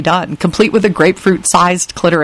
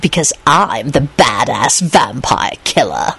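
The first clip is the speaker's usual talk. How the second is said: low, slow, gloating boast; smug grinning taunt; 5 semitones lower, slower than usual. Acted. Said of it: "Lupin hissed, grinning".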